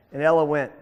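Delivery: plain reading voice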